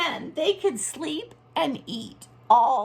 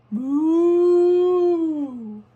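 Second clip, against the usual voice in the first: long, loud drawn-out moo; cow moo; 4 semitones higher, 6 dB louder than usual. Acted. Teacher voicing the cow for the mailman, long moo. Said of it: "And the cow said".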